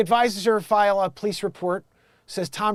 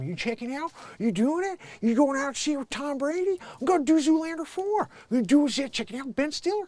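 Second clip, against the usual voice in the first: very high-pitched silly voice; silly posing model; 10 semitones higher than usual. Acted. Posing as blue steel model, silly high put-on voice. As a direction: chirp questions in a high, put-on voice.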